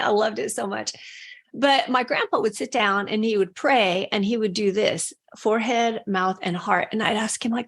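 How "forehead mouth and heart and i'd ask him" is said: ordinary talk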